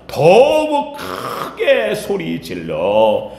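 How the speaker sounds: very slow, stretched words; emphatic drawn-out reading. Acted. Preacher reading the verse to the congregation, slowly drawn out.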